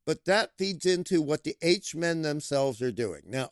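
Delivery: usual speaking voice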